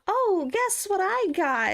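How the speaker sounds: high, drawn-out sing-song tease; excited mom. Retold or imitated. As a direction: imitate mom popping up with news. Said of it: "my mom will pop up and be like".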